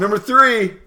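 high, loud countdown call; loud announcing. Acted. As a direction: hype up the number.

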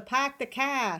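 lightly put-on Boston accent. Shown as a demonstration. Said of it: "can you do a Boston accent".

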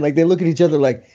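normal talking voice

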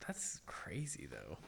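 hushed whisper. Real, not acted. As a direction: whisper it in disbelief. Reacting to death news.

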